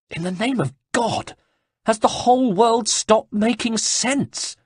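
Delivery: high, fast, exasperated monk voice; indignant monk. Acted. Monk protests to Ezio, high and fast. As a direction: blurt it out, fed up.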